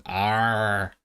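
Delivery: long American pirate "arr" without a trill. Shown as a demonstration. Showing chat the modern American rendition, untrilled pirate arr. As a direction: draw out an American-style arr. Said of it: "American rendition of the, of pirate speak".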